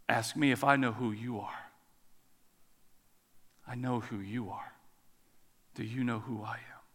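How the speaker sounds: low, hushed challenge; restrained rebuke. Acted. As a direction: let the voice sink, each question left hanging.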